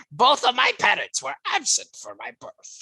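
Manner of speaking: high cartoon villain accent; Doctor Doofenshmirtz. Co-host quoting a childhood flashback, high cartoon accent. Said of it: "flashbacks to Doctor Doofenshmirtz's childhood. He's like".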